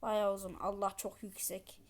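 usual speaking voice

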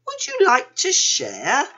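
high, loud rising question; hopeful invitation. A narrator's reading. Panda offers to share dinner, hopeful asking. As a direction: offer it eagerly, lifting at the end.

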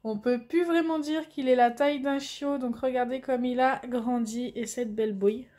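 normal talking voice

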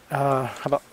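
plain reading voice

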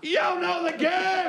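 very high, slow yell; shouting back. Retold or imitated. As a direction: holler back without thinking. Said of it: "shouted back".

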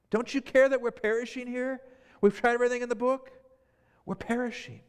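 high, strained cry of frightened fishermen; panicked disciples. Acted. Disciples crying out to sleeping Jesus, panicked and high. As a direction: plead with him as the boat sinks.

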